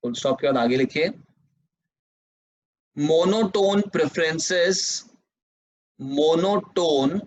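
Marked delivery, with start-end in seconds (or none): 3.0-7.2 very slow, each phrase repeated; teacher dictating notes word by word; slow dictation. Shown as a demonstration. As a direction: dictate the definition for students to copy. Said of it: "dictating second definition".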